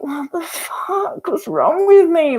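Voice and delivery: exasperated disbelief. Real, not acted. Talking to viewers about her past self, high incredulous exasperation.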